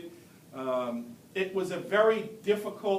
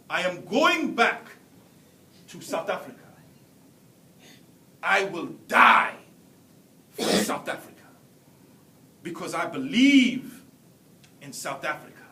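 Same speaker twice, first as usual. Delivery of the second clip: passionate vow; 2 semitones lower, 5 dB louder than usual. Retold or imitated. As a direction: declare it like an oath you'd die for. Classmate vows to die for country.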